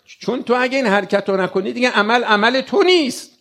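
normal talking voice

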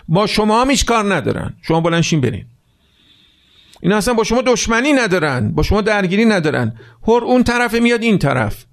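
impassioned preacher rising to a peak; full-force preaching. Acted. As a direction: drive it home to the crowd.

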